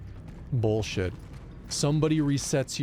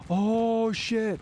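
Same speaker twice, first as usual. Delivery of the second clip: higher, startled oh shit; sudden realization; 9 semitones higher than usual. Real, not acted. Gamer suddenly remembers a chain he missed, startled. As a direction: jolt as it hits you.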